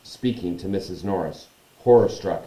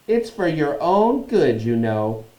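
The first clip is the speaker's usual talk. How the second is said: high, slow, falsely caring imp; mock-saintly; 7 semitones higher, 5 dB louder, slower than usual. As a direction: lecture sweetly with false concern. Peeves feigning saintliness.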